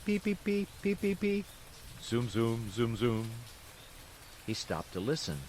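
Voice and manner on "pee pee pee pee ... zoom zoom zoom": pipe and drum sounds. A narrator's reading. Narrator mimics distant pipes and drums, playful. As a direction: imitate fife then drum. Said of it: "sounds of pipes and drums".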